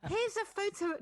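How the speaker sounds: high, loud mock caption voice; mocking influencer. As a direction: send up a show-off social post. Mimics yoga posters' social captions.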